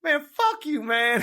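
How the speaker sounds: high mock-indignant Kentucky caller; comic envy in a Kentucky caricature; David. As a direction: curse in a put-on high country voice. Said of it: "he's from Kentucky".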